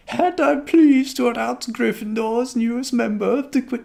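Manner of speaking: very high-pitched, badly faked Scottish; botched Scottish accent. McGonagall announcing to Gryffindor, botched Scottish accent. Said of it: "that's not it either".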